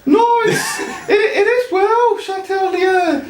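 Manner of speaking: put-on high, laughing Welsh accent. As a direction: keep the squeaky Welsh accent going. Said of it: "really thick Welsh accent".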